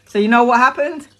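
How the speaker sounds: normal talking voice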